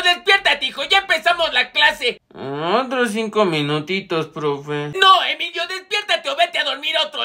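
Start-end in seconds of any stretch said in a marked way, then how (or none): 2.3-4.9 much lower, fading sleepy murmur; sleepy plea. Acted. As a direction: beg for more sleep, half asleep. Emilio dormido pide más tiempo. (voz adormilada, muy baja y apagada)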